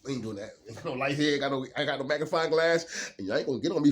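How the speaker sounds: normal talking voice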